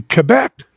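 Quebec said the French way, kay-BECK. Shown as a demonstration. Showing off saying Quebec the French way. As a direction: give Quebec its French sounds. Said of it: "notice I said that".

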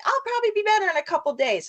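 high, fast brushing it off; breezy dismissal. Retold or imitated. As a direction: toss it off lightly and quickly. Imitating Alex dismissing illness.

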